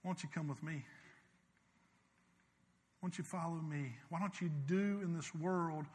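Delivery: soft, hushed voice of Jesus; tender invitation. Acted. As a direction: draw them in gently, almost a murmur.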